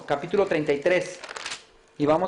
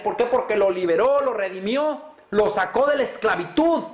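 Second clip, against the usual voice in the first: rising pulpit fervor, a high call-and-answer voice; fiery preaching; 7 semitones higher than usual. Acted. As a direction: preach it at full force, hermanos. Preacher building to full force.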